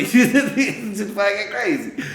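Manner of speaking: real laughter breaking through the repeat; laughing. Real, not acted. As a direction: let the laugh carry the words.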